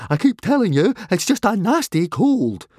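high, loud irritated whine; irritable. A narrator's reading. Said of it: "said Fishlegs irritably".

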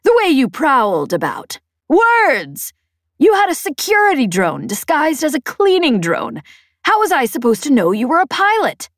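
high, loud exasperated rant; indignant shouting. A narrator's reading. Peg blames the pilot's disguises over the comm, shouting. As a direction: rant at full pitch, punching the exclamation. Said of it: "she shouted".

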